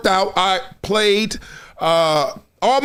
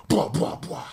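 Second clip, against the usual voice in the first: softer, distant echo fading away; far-off reply; 2 semitones lower, 8 dB quieter, slower than usual. Retold or imitated. A friend answering the call from afar, distant and fading. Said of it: "You hear somebody go".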